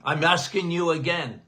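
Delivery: usual speaking voice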